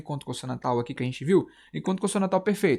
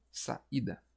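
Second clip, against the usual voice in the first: "Saída" quietly parted, sa; separated syllables; 3 semitones lower, 10 dB quieter than usual. Shown as a demonstration. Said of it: "quando eu separo".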